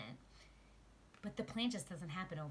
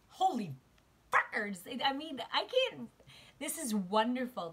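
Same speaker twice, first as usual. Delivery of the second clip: loud, high, overwhelmed exclamation; shocked joyful shout; excited outburst; 6 semitones higher, 7 dB louder than usual. Real, not acted. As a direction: burst out at the card in disbelief. Shocked by the card drawn.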